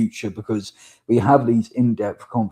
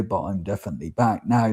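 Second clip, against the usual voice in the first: ordinary talk, nothing marked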